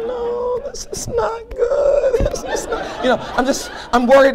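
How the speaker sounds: squeaky high, slow panicky voice; thinking in panic as the driver shoves him; panicky inner voice. Retold or imitated. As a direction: fret in a tiny high voice.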